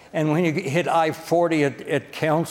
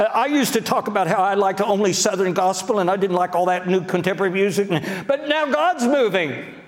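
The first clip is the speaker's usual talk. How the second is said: critics jumping on board; 5 semitones higher than usual. Acted. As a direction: imitate a critic eagerly claiming a part in it. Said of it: "even the critics will say something like this".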